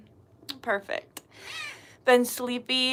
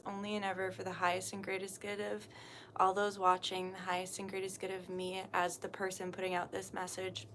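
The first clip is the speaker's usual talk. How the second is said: soft, hushed prayer voice, well under her chat level; quiet prayer; 3 semitones lower, 13 dB quieter than usual. Acted. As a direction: pray it softly and reverently, almost to yourself. Opening prayer before the stream.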